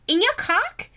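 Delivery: high, put-on girly voice, rising question; Poppy's voice. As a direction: squeak it as an astonished heroine. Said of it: "I think she talks like that".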